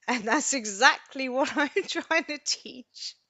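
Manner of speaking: high, slow, laughing through tears; tearful laughing. Real, not acted. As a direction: squeeze the words out between laughing and crying.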